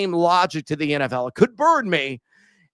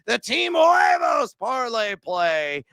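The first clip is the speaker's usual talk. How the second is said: announcer shout; 5 semitones higher, slower than usual. Acted. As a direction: call out the segment name like a ring announcer. Announcing his segment name.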